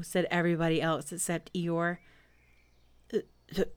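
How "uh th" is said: halting, husky thanks; growled. A narrator's reading. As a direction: stammer a gruff little thank-you. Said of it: "growled Pooh".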